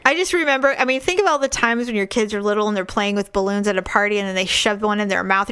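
usual speaking voice

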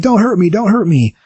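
put-on high, panicky little kid begging; a frightened child fleeing, mock panic; scared kid. Kid running from Ben, high mock terrified pleading.

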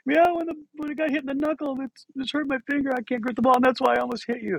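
high-pitched wailing explanation; mock crying child. Crying boy explaining his hurt finger, high mock wail. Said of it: "I just broke down crying".

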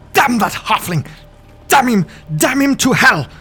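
high, furious spitting curse; shaking with anger. Acted. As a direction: spit the curse, trembling with rage. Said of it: "Harbin spits, his voice quivering with anger".

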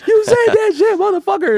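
high, loud re-enacted heckle; heckling. Fan in the crowd accuses the rapper, heckling. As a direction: yell it up at the stage like a heckler. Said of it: "I'm standing in the crowd heckling him".

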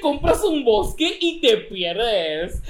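snooty fresita, high and slow. Acted. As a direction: play the stuck-up rich girl, dragging it out. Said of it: "este va a ser como que el fresita".

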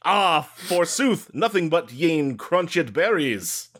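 slow, hammed-up old English lament; hammy stage actor. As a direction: overplay it like a bad Shakespeare actor. Forsooth, mock old English.

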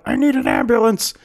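high, strained cry for help; mock wounded plea. Gangster coughing up blood after being shot, mock wounded plea. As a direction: plead as if badly hurt, play-acted. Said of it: "He's coughing up blood".

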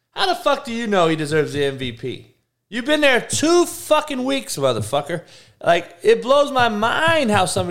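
high, loud indignant rant; ranting at Harden; angry rant. Real, not acted. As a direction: yell it up high, slowing down.

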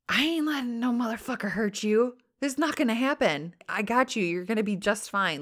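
retold tough guy calming a stabbed friend; protective reassurance. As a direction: swear you'll keep him safe. Tony reassuring dying Brody.

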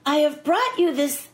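lightly voiced high line reading; a hint of a high, careful script read. Actress mimics co-star stuck on his line, lightly imitated. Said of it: "he says".

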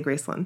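Graceland as GRACE-lund; Memphis pronunciation. Shown as a demonstration. How I say it around them, Graceland as GRACE-lund. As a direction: let the last vowel of Graceland shrink to "lund". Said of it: "when I'm around them".

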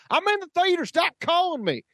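very high mock yell, Southern twang; imitated Southern yell. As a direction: mimic the moviegoer yelling at his caller. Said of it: "And he yells this".

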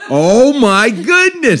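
high, loud and drawn-out oh my goodness; squealing with delight; overjoyed exclaim. Real, not acted. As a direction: gush it out slowly, thrilled. Delighted gasp at the idea.

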